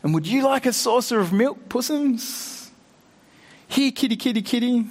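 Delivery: mock the captive lion like a pet; sneering sing-song teasing of the bound lion; jeering. A narrator's reading. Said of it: "jeering at him".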